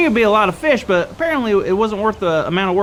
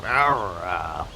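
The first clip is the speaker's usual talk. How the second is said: low rumbling pirate growl; 8 dB quieter than usual. Shown as a demonstration. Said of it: "lower your voice to, uh, like a growl".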